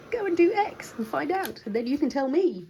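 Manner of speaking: high emphatic just-do-it; exasperated. Real, not acted. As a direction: push them to try it. Exasperated advice to viewers.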